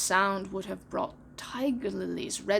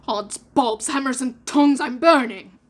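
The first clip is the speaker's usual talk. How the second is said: burning yelp; 11 dB louder than usual. Acted. Hook jumps from hot seat.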